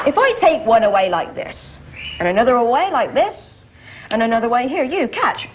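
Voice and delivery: ordinary talk, nothing marked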